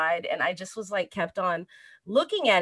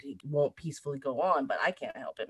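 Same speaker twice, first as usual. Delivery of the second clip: usual speaking voice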